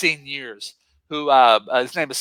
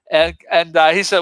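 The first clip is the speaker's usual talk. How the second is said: ordinary talk, nothing marked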